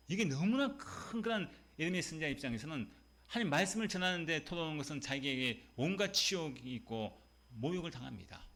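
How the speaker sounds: ordinary delivery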